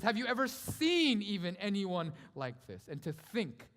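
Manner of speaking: loud, high, drawn-out sermon cry; impassioned preaching. Acted. Preacher announces Christ's coming to the church, impassioned. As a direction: preach it at full force.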